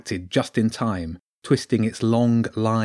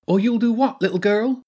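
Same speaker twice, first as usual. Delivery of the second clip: high-pitched sneering polecat villain; 11 semitones higher, 3 dB louder than usual. A narrator's reading. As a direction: sneer it in a high, taunting creature voice. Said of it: "sneered the lounger".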